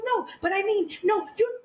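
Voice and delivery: high-pitched mock protest; flustered backpedalling. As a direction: imitate her scrambling to explain herself. Acting out her flustered backpedalling.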